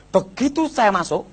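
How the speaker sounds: ordinary talk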